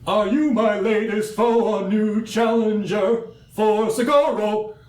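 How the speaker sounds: high, slow, grand warrior voice; booming warrior challenge. Acted. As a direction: proclaim it like a proud duelist.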